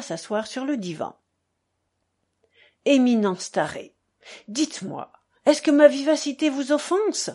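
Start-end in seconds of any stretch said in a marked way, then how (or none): 2.8-7.3 louder, rising into a high-pitched question; sudden exclamation. A narrator's reading. Fiodor suddenly asks the staré if he offends, exclaiming. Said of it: "s'exclama soudain Fiodor Pavlovitch".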